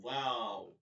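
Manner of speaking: "ওয়াও" with the lips rounded; rounded-lip wow. Shown as a demonstration. Teacher showing the letter waw, "ওয়াও" with rounded lips. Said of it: "ঠোঁট গোল করে".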